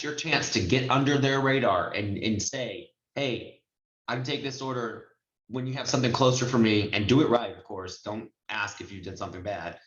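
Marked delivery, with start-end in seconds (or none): none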